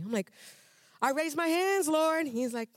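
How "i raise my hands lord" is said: high, loud pleading protest; insist, louder and higher; arguing her case to the Lord, louder; indignant protest. Retold or imitated. Retelling arguing with God.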